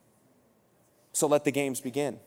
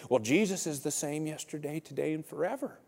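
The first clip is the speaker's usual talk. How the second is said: soft, grudging Southern voice; put-on Southern grumbling; grumpy Southern guy; 7 dB quieter than usual. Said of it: "the grumpy Southern guy is my second voice".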